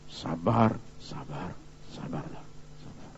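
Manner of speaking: fading into a whisper; a preacher voicing someone telling himself to stay patient; whispered self-calming. Voicing someone suppressing anger.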